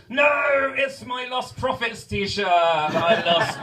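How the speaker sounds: high, loud wail of horror; mock anguished cry. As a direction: cry out in drawn-out dismay. Wail over an unwanted old t-shirt.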